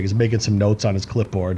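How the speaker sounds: usual speaking voice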